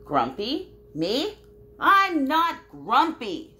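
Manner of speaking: high, loud, slow protest; indignant denial. Acted. Reading aloud, Jim denies being grumpy to friends. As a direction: rise up in offended disbelief. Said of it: "said Jim".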